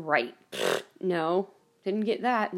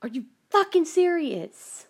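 higher, louder and slow; furious disbelief; 7 semitones higher, 5 dB louder, slower than usual. Retold or imitated. Reacting to the shooting news, angry louder outburst. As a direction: spit out the disbelief. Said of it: "And I'm like".

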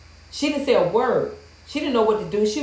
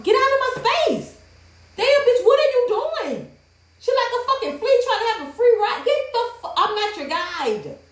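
high-pitched exasperated rant; re-enacted outrage; 12 semitones higher than usual. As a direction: let the indignation shoot way up high. Re-enacted outrage at space invader.

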